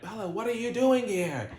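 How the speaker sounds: high-pitched mock yell; retold yell. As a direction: startle her with an imitated, high-pitched shout. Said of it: "he yells at her like".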